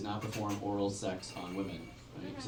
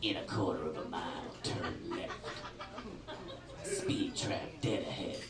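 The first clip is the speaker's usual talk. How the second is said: lower, softer [name]; 5 semitones lower, 8 dB quieter than usual. Retold or imitated. GPS as [name] warns driver, low impression. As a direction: give directions in a heavy, laid-back producer voice. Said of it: "if you've never heard his voice".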